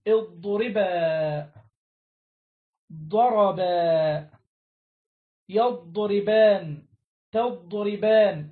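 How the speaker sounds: each verb form called out slowly and loudly, one by one; drilled examples. Shown as a demonstration. Teacher drilling verb forms for students, slow loud emphasis. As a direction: hammer each conjugation home, slow and loud. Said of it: "كما تقول".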